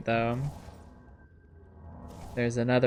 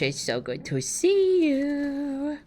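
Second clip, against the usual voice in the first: cutesy greeting; 11 semitones higher, slower than usual. Pretend. Greeting a game character.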